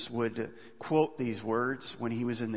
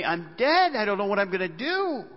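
crying out to God; 6 semitones higher, 4 dB louder than usual. Acted. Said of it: "they cry out to God".

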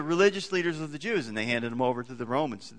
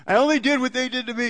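put-on high, fast sulky kid excuse; whiny child; 9 semitones higher, 5 dB louder, faster than usual. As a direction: play a child talking back, higher and quicker.

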